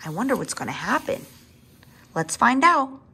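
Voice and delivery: ordinary delivery, nothing marked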